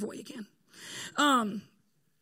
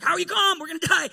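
loud, high, panicked cry; panicked shout; 5 semitones higher, 9 dB louder, faster than usual. Retold or imitated. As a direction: yell it like a disciple sure they're about to die. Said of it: "and he's like".

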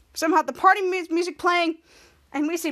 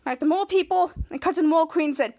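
ordinary delivery, nothing marked

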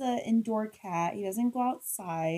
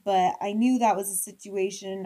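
usual speaking voice

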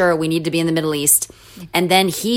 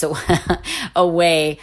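real laugh breaking into the word; much slower than usual. Real, not acted. Missionary telling how churches sent them away, laughing. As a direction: crack up midway through the last word. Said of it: "I'm laughing 'cause I don't".

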